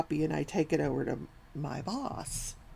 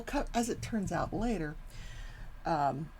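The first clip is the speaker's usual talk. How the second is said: usual speaking voice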